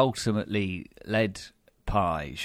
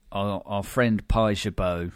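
ordinary delivery

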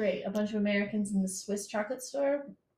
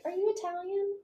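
imitating French girl; 10 semitones higher than usual. Girl's question quoted.

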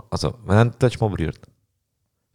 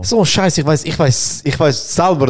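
teasing protest; 6 semitones higher, slower than usual. Real, not acted. Calls out friend's evasive answer.

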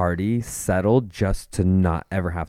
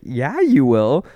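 drawn-out, louder, higher insistence; retold indignant demand; 5 semitones higher, 6 dB louder, slower than usual. Client answering the bank, retold indignant demand. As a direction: stretch it out as a pointed comeback.

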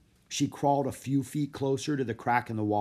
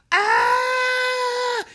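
piercing high shriek, loud; girl's terrified scream; 11 dB louder than usual. A narrator's reading. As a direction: scream in sudden terror. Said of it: "she screamed".

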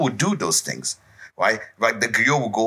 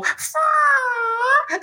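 high strained effort cry. Acted. Elephant grunts lifting tree.

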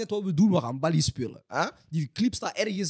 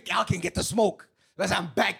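normal talking voice

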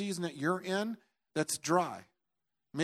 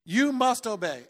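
shouting the name high and loud, then commanding; loud proclaiming; 8 semitones higher, 10 dB louder, slower than usual. Retold or imitated. Preacher reenacting a shouted command to his wrist, loud proclaiming. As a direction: command the wrist to obey, full force. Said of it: "I'm proclaiming".

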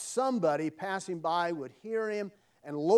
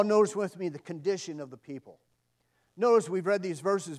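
ordinary talk, nothing marked

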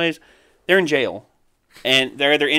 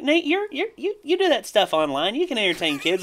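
high mock granny voice; nagging granny; 9 semitones higher than usual. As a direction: squeak it like a pestering grandma. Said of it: "It's like".

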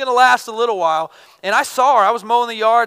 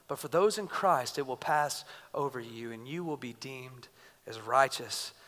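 soft voice of God; 6 semitones lower, 10 dB quieter than usual. Acted. Voicing God to the listener.

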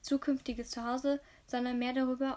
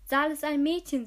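aufgeregt; 3 semitones higher, 7 dB louder than usual. Acted. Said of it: "zeigte auf sie".

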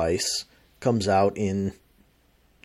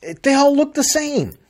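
exasperated rant; 8 semitones higher, 4 dB louder than usual. Real, not acted. Complaining rant about identical phones.

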